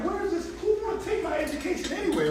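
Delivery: high-pitched, soft mock puzzlement; mock bewilderment. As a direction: ask it high, as if baffled. Mocking his mother's advice on education.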